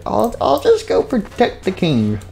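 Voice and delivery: high, slow little voice; put-on small, hesitant guard voice; meek guard. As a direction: back out meekly in a high pretend voice. Timid guard backs out, acted.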